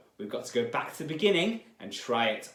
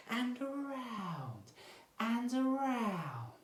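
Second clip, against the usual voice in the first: soft, high, slow and sweet; gentle voice; 10 semitones higher, 6 dB quieter, slower than usual. Shown as a demonstration. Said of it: "a very nice gentle voice".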